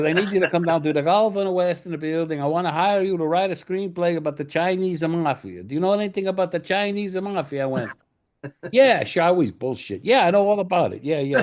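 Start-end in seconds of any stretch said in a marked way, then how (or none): 0.0-7.6 put-on high, sing-song Italian accent; Dino De Laurentiis. Producer offers writer a screenplay job, high Italian accent. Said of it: "when you do the accent". 8.7-11.4 loud, high, over-eager yeses; glib bluffing. Retold or imitated. Writer bluffs the producer about knowing the mafia, loud glib yeses.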